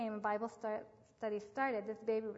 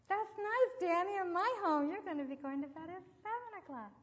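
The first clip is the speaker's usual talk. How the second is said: bright firm reply; 8 semitones higher than usual. Retold or imitated. Firmer second reply to boy.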